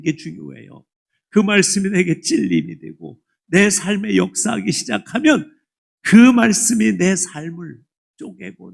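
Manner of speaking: loud, urgent sermon climax; fervent preaching. Acted. Pastor urging the congregation to listen, loud and urgent. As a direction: drive it home with full force.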